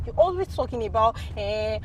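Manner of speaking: ordinary talk, nothing marked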